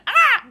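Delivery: high loud mock yelp; imitated yelp of pain. Teen imitating the boy hitting his shin, loud high yelp. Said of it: "and he went".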